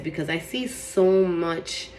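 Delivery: normal talking voice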